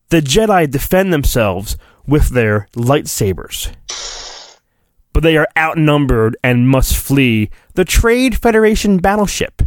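ordinary delivery

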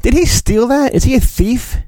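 usual speaking voice

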